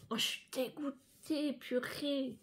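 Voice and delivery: real dismay, soft and drawn out; slow, subdued lament on the edge of tears; close to tears. Real, not acted. Vlogger gutted in front of her viewers, tearfully subdued. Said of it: "mes larmes, ils sont ici, là, juste au bord".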